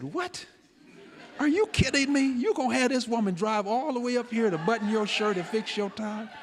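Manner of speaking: high-pitched disbelief, retold for effect; incredulous. Son challenges father over shirt and tie, incredulous. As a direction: pitch it way up, aghast at the request.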